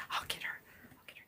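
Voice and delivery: hushed whisper so the dog won't hear; playful conspiratorial whisper; sneaky whisper. Real, not acted. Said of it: "I tricked her".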